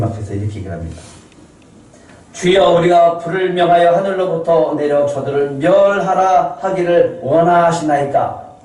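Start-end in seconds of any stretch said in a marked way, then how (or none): 2.3-8.6 raised, pleading disciple voice; zealous disciples. Retold or imitated. Disciples asking Jesus for fire, raised and eager. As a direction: play the eager disciples begging to burn the village.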